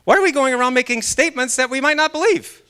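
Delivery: a eureka moment talking to himself; realizing aloud. Retold or imitated. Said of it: "So I said".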